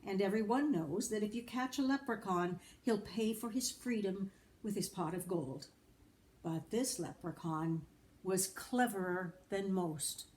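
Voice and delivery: usual speaking voice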